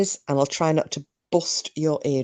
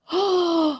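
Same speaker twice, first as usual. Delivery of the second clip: gasp; 12 semitones higher than usual. Real, not acted. Realizes friend's birthday.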